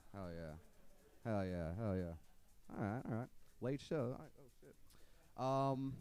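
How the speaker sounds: ordinary talk, nothing marked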